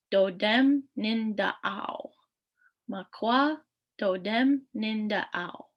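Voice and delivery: Makwa Dodem Nindao drawn out slowly; slow model pronunciation. Shown as a demonstration. Teacher slowly models the other clan phrase. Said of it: "another way you could say this is".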